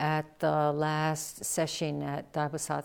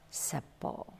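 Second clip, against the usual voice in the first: normal talking voice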